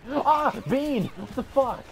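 high, loud shriek of shock; startled scream. Real, not acted. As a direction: scream as you're jumped. Ambushed suddenly by enemy.

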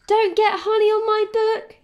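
high, loud scolding order; stern warning. A narrator's reading. Tilly guards her book from honey, stern and loud. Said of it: "Tilly said sternly".

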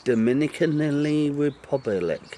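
Dominican Republic slowly, each syllable spelled out. Shown as a demonstration. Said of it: "Say it slowly".

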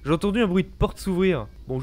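ordinary talk, nothing marked

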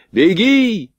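high, urgent yelled order; shouted command. A narrator's reading. Officer sends soldiers running, shouted command. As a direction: yell it high, holding the vowel. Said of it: "скомандовал Родионов".

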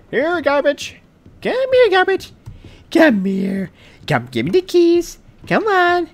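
high coaxing pet-call voice. Pretend. Player coaxing a garbage can like a dog, high pet-call voice. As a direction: coax the trash can like a dog.

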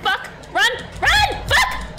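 starts urgent, rises to high screaming; building panic. Real, not acted. Player urging cat to run, building from urgent to screaming. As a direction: urge the cat on until you shriek. Fleeing Zurks again, rising panic.

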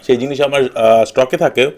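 speaking with food in mouth; eating between words; talking while chewing. Real, not acted. Seen 0: Eats rice by hand between phrases; mouth seems partly full while speaking.

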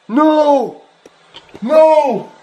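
high, drawn-out cries of dismay; panicked, high-pitched disbelief; horrified panic. Real, not acted. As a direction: wail in real horror at the damage. Discovers house wrecked, in panic.

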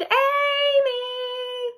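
high, loud sung-out yell; a brother hollering up the stairs; brother's yell. Acted. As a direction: yell her name up the stairs. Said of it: "yelled as loudly as he could".

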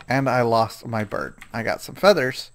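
normal talking voice

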